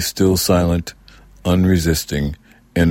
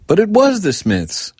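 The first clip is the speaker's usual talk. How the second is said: put-on excited child; 14 semitones higher than usual. A narrator's reading. Girl insisting to her mother, excited. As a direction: call it out, bursting with excitement.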